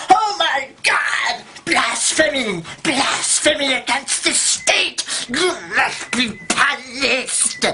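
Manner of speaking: high, slow wailing mock outrage; hammed-up cry of blasphemy, every word stretched; horrified slave master. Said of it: "the slave masters go".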